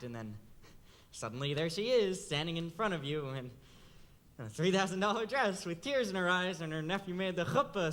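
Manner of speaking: ordinary delivery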